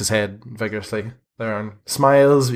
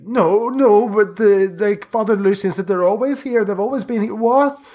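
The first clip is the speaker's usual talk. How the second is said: high, protesting voice; shocked boy; 12 semitones higher, 4 dB louder than usual. Acted. Boy cites Father Lucien on protection, shocked. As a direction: plead in high-pitched disbelief. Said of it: "he's totally shocked".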